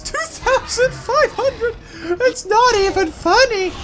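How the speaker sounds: high-pitched squeal of disbelief; real high, stretched-out disbelief; incredulous outburst. Real, not acted. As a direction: squeal it, appalled at the score. Reacting to an absurd attack score.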